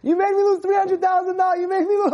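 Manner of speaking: high, shouted mock fury; imitated outraged accusation, pitched high; flipped out. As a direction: act out losing it over a huge loss. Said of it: "the guy flipped out".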